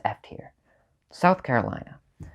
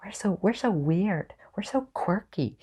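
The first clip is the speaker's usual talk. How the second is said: mock a self-consciously quirky local, pitched up; obnoxious Portlander; 8 semitones higher than usual. Ranker mimicking Portland's boasting, obnoxious and high. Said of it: "Portland's kind of obnoxious with its".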